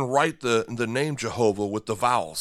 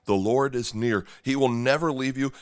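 ordinary talk

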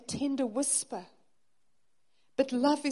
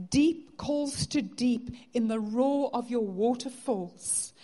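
louder, impassioned psalm reading, slowing on the longing lines; fervent scripture reading; 5 dB louder than usual. Acted. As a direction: proclaim the psalm with growing fervour. Reads psalm with rising fervour.

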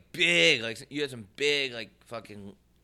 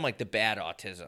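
ordinary talk

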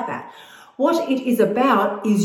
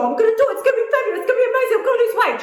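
much higher, gushing mock voice with laughter; mocking eager self-promise; 13 semitones higher, much faster than usual. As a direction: parody your own overexcited promises, laughing. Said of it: "when you go".

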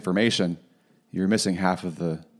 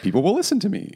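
much higher put-on voice; high silly voice; 12 semitones higher, faster than usual. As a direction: keep the voice pitched way up. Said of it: "Here I am changing my voice right now".

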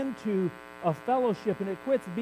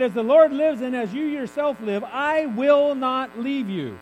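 loud, slow, every word weighted; solemn vow; 3 semitones higher, 6 dB louder, slower than usual. Acted. Elisha vowing loyalty to Elijah, loud and slow. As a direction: plant your feet and refuse to leave.

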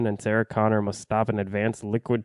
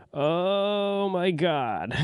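exasperated groan; 8 semitones higher, much slower than usual. Real, not acted. Exasperated at fan-service callbacks.

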